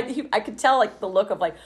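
ordinary delivery

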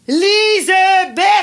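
high, drawn-out holler of a name; shouted call. Acted. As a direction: call far off, stretching each syllable. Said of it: "Qu'est-ce que t'as à crier de même?". (cri d'appel aigu et étiré)

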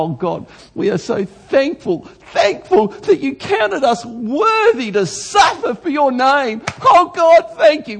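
high, slow, ecstatic prayer voice rising to a cry; fervent prayer. Retold or imitated. Apostles on their knees thanking God, high and fervent. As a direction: pour out thanks like an overjoyed apostle. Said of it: "they go".

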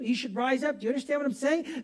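ordinary delivery

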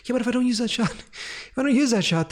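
high-pitched pleading protest. Retold or imitated. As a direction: argue back in a high, worked-up voice. Acted argument with colleague over a shot.

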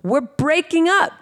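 high, slow insistence; mock indignant. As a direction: drag it out high in protest. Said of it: "I'm like".

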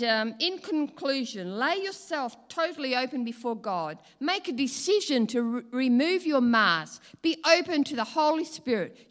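loud, driving sermon close; forceful preaching. Acted. Preacher urging the congregation to remove their masks, loud and forceful. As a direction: preach it out, building conviction.

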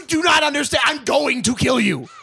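high yelled threats; furious shout. Acted. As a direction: rage at the caller. Threatening the caller.

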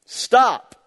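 loud, high, sharp stop; stern shouted stop; emphatic command. Retold or imitated. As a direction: bark each stop like a firm order. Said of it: "it's just this emphatic phrase".

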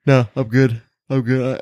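talking through a yawn; stretched yawny words. Real, not acted. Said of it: "excuse me while I yawn".

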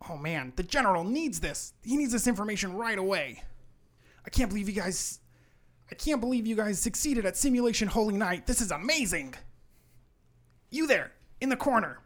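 high, loud elf in a flurry; urgent excited elf. Acted. Elf needing to tell the general right away, excited. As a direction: gush with amazement, then call out urgently.

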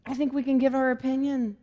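ordinary delivery

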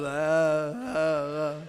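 low, mouth-hung-open "uh"s; dumbfounded stammer. Retold or imitated. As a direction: act struck dumb, stuck on one low sound. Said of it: "I couldn't say nothing".

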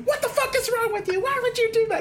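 very high-pitched mock tirade; hammed-up, high yell of outrage; retold screaming. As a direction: imitate storming in and screaming at your parents. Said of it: "then screaming at her parents".

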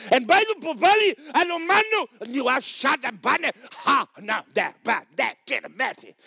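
high, loud burst of forceful praying; fierce prayer. Acted. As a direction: shout it out like battling in prayer. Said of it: "you're liable to pray like that".

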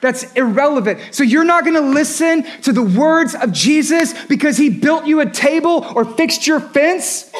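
usual speaking voice

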